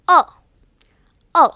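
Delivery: "a" as a small neutral uh; schwa. Shown as a demonstration. Teacher showing the small sound, schwa. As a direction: say "a" as a short schwa. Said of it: "Schwa is just a small sound".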